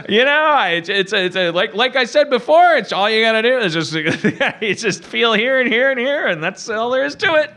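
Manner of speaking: a hint of a chatty doctor; retelling the doctor's casual instructions; mild doctor voice. Doctor quoted explaining.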